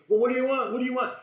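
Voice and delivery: high, loud rude snapping at the caller; curt mock rude answer; rude bark. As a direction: bark back like an annoyed person interrupted at dinner. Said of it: "you don't want them to bark at you".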